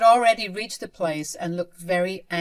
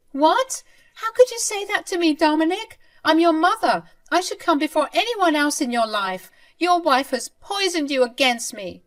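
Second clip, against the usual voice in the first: shrill wounded mother; 10 semitones higher, 6 dB louder than usual. Acted. Mother wounded by son's rejection.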